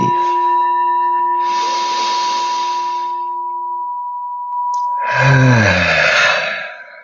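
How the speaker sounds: long, audible exhale of relief; sigh of relief. Shown as a demonstration. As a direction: let out a big, slow sigh of relief. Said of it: "a nice deep breath and a sigh of relief".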